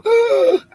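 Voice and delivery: sharp high gasp of alarm; mock gasp. Pretending to be shocked by aliens landing, high gasp. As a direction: act the shocked gasp he wouldn't do. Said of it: "I wouldn't go".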